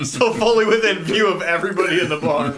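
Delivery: laughing while talking fast. Real, not acted. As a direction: talk through laughter. Laughing at the player's choice.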